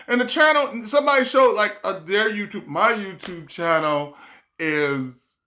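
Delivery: normal talking voice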